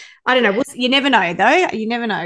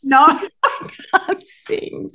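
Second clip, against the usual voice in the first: laughing; 8 semitones higher, slower than usual. Real, not acted. Laughs while denying singing.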